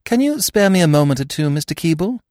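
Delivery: put-on polite young lady asking a favour; Eve's voice. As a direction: Eve politely asking Mister Keeble for a moment. Eve voiced, higher than narration.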